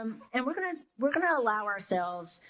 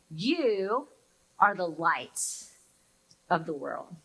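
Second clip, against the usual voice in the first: slow, lowered, solemn reading again; solemn reading repeated; 4 semitones lower, slower than usual. Shown as a demonstration. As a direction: let the words settle slowly. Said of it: "Hear those words again".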